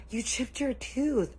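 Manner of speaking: gasp, then high, slow exclamation; imitated shock. Onlookers seeing the boy's tooth, gasping high shock. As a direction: draw it out in horrified mock disbelief. Everyone reacts to chipped tooth.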